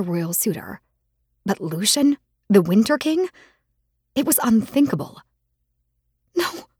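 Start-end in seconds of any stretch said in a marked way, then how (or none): 6.3-6.7 high, choked no; strangled cry. Acted. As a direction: let the no catch in your throat. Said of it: "A strangled cry came from my lips".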